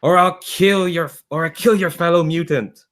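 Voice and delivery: high-pitched villain's threat called out; long high STOP, then the threat; acted shout. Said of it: "you just hear someone shout".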